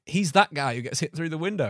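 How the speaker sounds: ordinary talk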